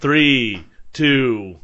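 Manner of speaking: loud, falling count of three, two; loud countdown. Shown as a demonstration. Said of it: "You don't say the word".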